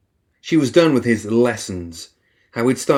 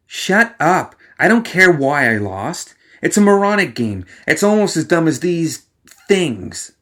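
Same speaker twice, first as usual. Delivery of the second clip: higher, louder, furious sore loser; angry outburst; 6 semitones higher, 3 dB louder than usual. Acted. Alice lashing out at Leviticus after losing chess. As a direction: snap at him in a rage.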